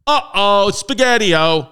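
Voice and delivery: silly uh-oh. Pretend. Comic reaction to ship exploding.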